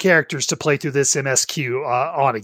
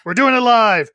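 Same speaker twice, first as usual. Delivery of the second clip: mock shout; 7 semitones higher, 3 dB louder than usual. Echoing the catchphrase.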